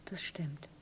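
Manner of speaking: slow, faint whisper. Acted. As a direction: breathe it out softly. Woman whispers a quiet aside. (leise geflüstert)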